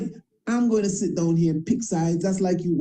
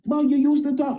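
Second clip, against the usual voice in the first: high, louder mocking jab; mock questioner; 9 semitones higher, 4 dB louder than usual. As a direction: throw their complaint back, pitched up. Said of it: "I say".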